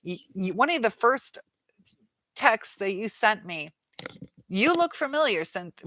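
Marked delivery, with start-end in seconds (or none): 4.5-5.8 mocking quote. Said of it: "well, you didn't say Cynthia".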